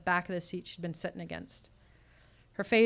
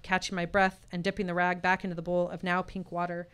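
usual speaking voice

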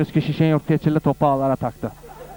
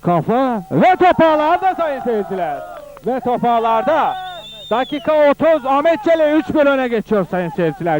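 higher, louder goal call; screaming football commentator; 8 semitones higher, 3 dB louder, slower than usual. Acted. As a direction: shout the goal to the crowd. Second goal called.

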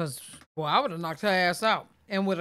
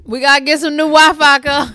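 half-yelling a joke about bad Wi-Fi; loud excited complaint; 6 semitones higher, 9 dB louder than usual. Real, not acted. Joking to friends about the Wi-Fi, loud and high.

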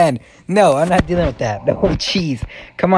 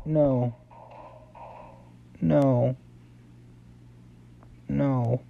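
soft, spaced-out refusals; dejected; 9 dB quieter, much slower than usual. Real, not acted. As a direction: say each one quietly in defeat. Dejected after losing the game.

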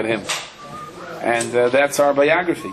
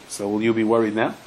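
ordinary talk, nothing marked

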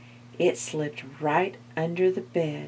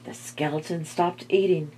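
ordinary delivery

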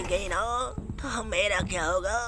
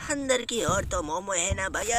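ordinary delivery

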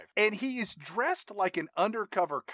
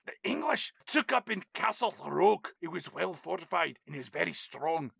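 put-on Billy Connolly's Scottish accent. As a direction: lecture in a mock Scottish accent. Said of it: "the Scottish accent of Billy Connolly".

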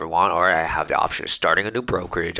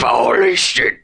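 loud, drawn-out, higher exclamation; mock astonishment; 4 semitones higher, 6 dB louder, slower than usual. Reacting to a commenter's plot theory, mock astonished. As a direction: blow up with exaggerated shock.

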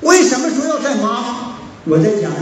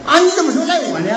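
higher and quick, a touch of offended protest; mock indignant comeback, fast; retold huffy retort; 7 semitones higher, faster than usual. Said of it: "那姑娘还来气儿了". (模仿生气地顶嘴，语速快)

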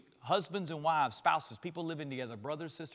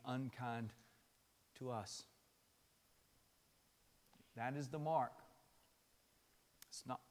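soft, lower, gentle sermon voice; intimate, hushed appeal; quiet tender preaching; 4 semitones lower, 5 dB quieter than usual. Acted. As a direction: draw them in softly.

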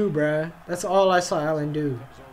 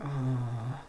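soft, low tired yawn; 7 semitones lower, 13 dB quieter than usual. Real, not acted. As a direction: let out a long, quiet yawn. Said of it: "I'm yawning so hard I'm crying".

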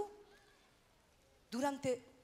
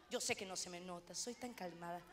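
ironic understatement; 7 semitones lower, 11 dB quieter than usual. Acted. Ironic aside after "soy gritona.

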